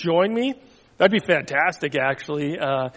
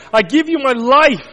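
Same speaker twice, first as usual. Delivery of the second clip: crying out; 5 semitones higher, 5 dB louder than usual. Acted. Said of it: "Jesus cried".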